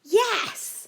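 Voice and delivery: high, loud burst of glee; eager reply. A narrator's reading. Joe and A J reply together, thrilled. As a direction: cry it out like delighted children. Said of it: "replied together".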